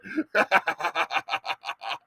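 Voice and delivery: evil villain cackle; cartoon laugh. Real, not acted. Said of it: "nineteen eighties evil cartoon laugh".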